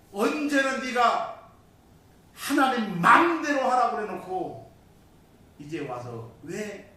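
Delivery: high, loud scolding from above; acting God calling him out; God's rebuke. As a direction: rebuke him forcefully for his own words. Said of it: "하나님이 들려오는 소리가".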